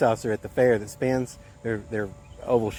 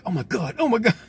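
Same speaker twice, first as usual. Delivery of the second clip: freaking out; 10 semitones higher than usual. Retold or imitated. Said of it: "dude was just freaking out".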